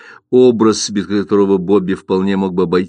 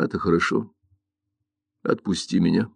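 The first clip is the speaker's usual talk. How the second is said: quiet; 3 semitones lower, 8 dB quieter than usual. Acted. Son pulling away quietly.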